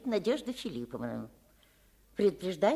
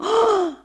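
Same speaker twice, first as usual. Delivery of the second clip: shocked sharp gasp, high and loud; indignant loud gasp; 12 semitones higher, 11 dB louder than usual. Acted. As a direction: gasp in outrage. Woman offended by appraiser.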